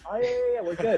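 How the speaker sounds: high, eager bluffing yeahs; fake confidence. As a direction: pretend you understood everything. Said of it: "And I'm like".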